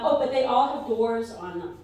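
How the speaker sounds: usual speaking voice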